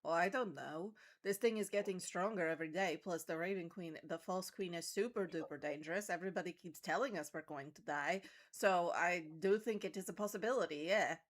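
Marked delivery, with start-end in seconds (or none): none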